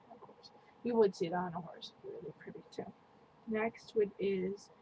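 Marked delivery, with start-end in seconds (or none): 0.1-0.5 quiet. Real, not acted. Quiet repeat to herself.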